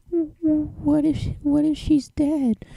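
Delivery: very high, slow frightened voice; timid fearful voice. A narrator's reading. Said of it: "the yellow Pegasus wondered".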